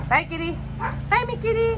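dote on the cat in a high sing-song. Real, not acted. Cooing to her cat.

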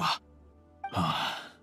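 low, soft exhaled sigh; resigned sigh before confiding; weary sigh. Acted. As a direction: let out a troubled breath. Father sighs over his son.

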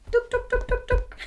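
high, bouncy drum imitation "tuk tuk"; drum sound. Host imitating a toy drum for children. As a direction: tap out a little drum for the kids.

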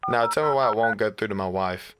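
ordinary delivery

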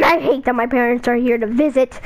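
usual speaking voice

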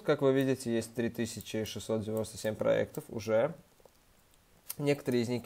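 usual speaking voice

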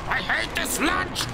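high-pitched angry yelling; real frustrated outburst; raging complaint. Real, not acted. Raging at the game.

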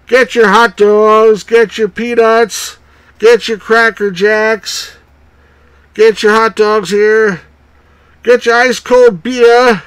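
slow, high, loud ballpark vendor call; stadium vendor hawking. Acted. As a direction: hawk snacks up the stands.

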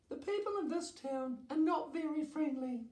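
very high, quick little voice; high storybook dragon, brisk; dragon character Dora. A narrator's reading. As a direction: complain in a high-pitched character voice.

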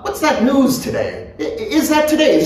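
high-pitched voice for a nosy acquaintance; high, inquisitive character voice; eager acquaintance. A narrator's reading. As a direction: pitch the questions way up, a little hesitant. Lyons accosts Bloom in dialogue.

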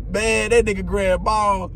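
normal talking voice

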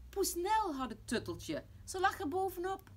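loud, high-pitched surprise; excited exclamation. Acted. Pap reveals the cat lay on it, excited exclamation. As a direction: announce the find with amazement. Father exclaims over found toy.

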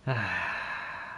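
long, soft exhaled sigh; weary sigh. Real, not acted. Gamer worn out by the game, sighing. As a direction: let out a slow falling sigh.

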